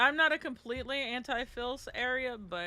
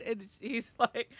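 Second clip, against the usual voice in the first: normal talking voice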